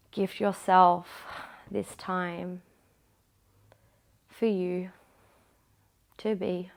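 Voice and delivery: low, soft, unhurried relaxation voice drifting into whispers; guided meditation. Acted. Instructor guides the class into final rest, soft meditation voice. Cool-down relaxation after the workout.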